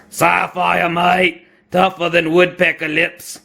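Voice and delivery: hammed-up Aussie accent; Australian accent. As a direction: put on a cartoon Australian mate. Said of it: "my use of an Australian accent".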